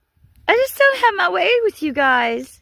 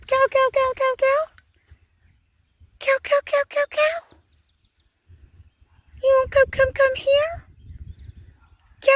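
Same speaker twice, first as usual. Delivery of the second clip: calling cows; 7 semitones higher than usual. Real, not acted. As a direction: coax the cattle over in a high little voice. Calling cattle over a fence.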